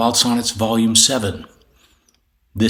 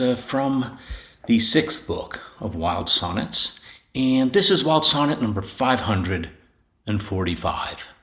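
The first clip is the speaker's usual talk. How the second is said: usual speaking voice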